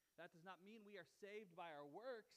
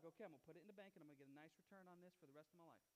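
softer, fast sensible talk; mock prudent saver; 5 semitones lower, 7 dB quieter, faster than usual. Lottery winner mocked as a cautious saver, quick and soft. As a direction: rattle off the careful plan. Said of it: "be like".

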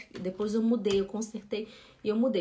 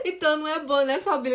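laughing; 5 semitones higher, 5 dB louder, faster than usual. Real, not acted. Laughing about student on bus.

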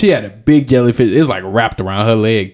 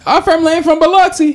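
high, mocking parrot of his line; sing-song mockery of a cousin; mocking echo; faster than usual. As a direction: throw his words back in a silly high voice. Mocking the other's Biloxi claim.

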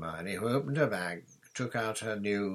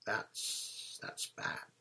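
voiceless, much softer falter; hushed dread; 7 dB quieter, much slower than usual. A narrator's reading. Ron telling Hermione the Grim is bad, hushed dread. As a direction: let the voice drop away to a whisper.

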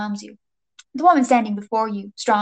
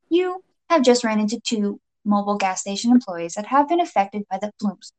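usual speaking voice

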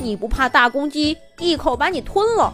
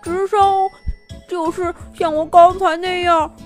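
mumbled through a swollen face; marbles in mouth; 7 semitones higher than usual. Acted. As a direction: slur the words as if swollen. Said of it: "含糊不清地说".

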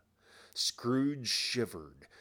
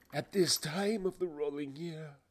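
tormented ghost; 8 semitones higher than usual. Acted. Ghost continues its lament.

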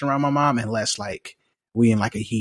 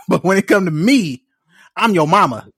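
mock mom; 7 semitones higher, 6 dB louder than usual. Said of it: "my mom said it".

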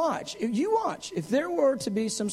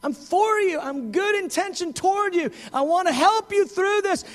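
eager reassurance; 6 semitones higher than usual. Retold or imitated. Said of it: "He goes".

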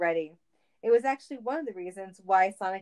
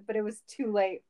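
usual speaking voice

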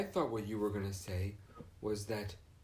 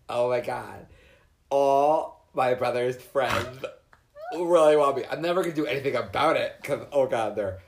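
mock gushing teen; 3 semitones higher, 11 dB louder than usual. Mocking her teenage self to co-host, loud giddy gush. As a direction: ham up a flattered teenage girl. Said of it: "you're like".

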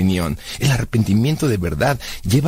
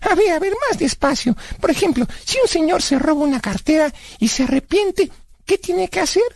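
put-on high-pitched kid character puzzling it out; high cartoonish inquisitive voice; childlike Blas, questioning. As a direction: ask in a high, naive character voice.

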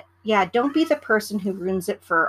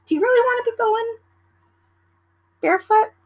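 high, fast disbelieving question; a rising, fast, astonished barefoot question; incredulous; 14 semitones higher, 5 dB louder than usual. Real, not acted. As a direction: ask it in total disbelief.

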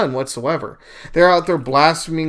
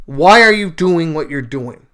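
louder, pleading question; calling out to God; 2 semitones higher, 7 dB louder than usual. Retold or imitated. A man questions God about his life, calling out. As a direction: act out a frustrated call upward. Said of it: "Calling out to God".

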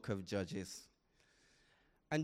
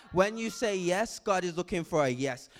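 ordinary delivery

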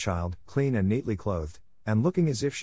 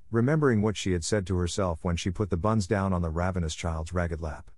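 ordinary delivery

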